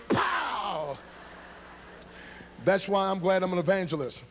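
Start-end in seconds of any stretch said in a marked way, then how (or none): 0.1-1.0 exploding, drawn-out pow; impact noise. Retold or imitated. Board striking the little guy, explosive pow. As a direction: burst it out as a sound effect. Imitates board hitting the boy.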